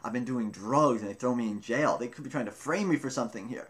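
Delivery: normal talking voice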